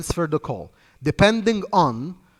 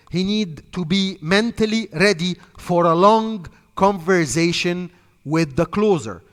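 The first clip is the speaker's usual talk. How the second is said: usual speaking voice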